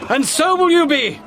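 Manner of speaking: police shouting during a raid; shouted arrest. Acted. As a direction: yell it at the fleeing men.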